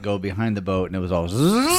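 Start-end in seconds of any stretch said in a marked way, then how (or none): 1.3-1.8 drawn-out zipping buzz; imitated reel buzz. Retelling the line let go behind the boat, buzzing imitation. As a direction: mimic fishing line whizzing out.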